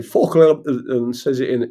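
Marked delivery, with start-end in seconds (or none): 0.0-0.6 louder mock London accent. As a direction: imitate a Londoner swearing, louder. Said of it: "in a London accent".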